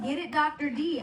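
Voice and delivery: mock yell, showing how to heckle the film; demonstrated yell. Shown as a demonstration. Coaching the guest to call out in the theater, mock yelling. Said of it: "yell out".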